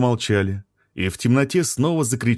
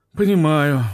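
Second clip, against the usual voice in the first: grumbled; 6 semitones higher, 4 dB louder than usual. A narrator's reading. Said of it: "буркнул биолог и вздохнул".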